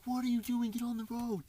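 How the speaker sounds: high-pitched imitation of an alarmed friend; high, urgent quote at normal volume; retold panicked scolding. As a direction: urge the driver in mock panic. Imitates friend yelling at driver.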